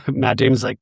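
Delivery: ordinary delivery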